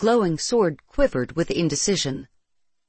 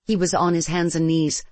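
ordinary delivery, nothing marked

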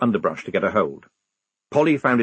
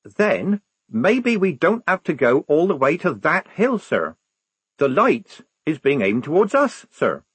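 put-on higher-pitched young recruit voice; lightly acted, insistent recruit; lightly voiced eager soldier; 7 semitones higher, 3 dB louder than usual. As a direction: press the point in a higher voice. Figure in narrated story.

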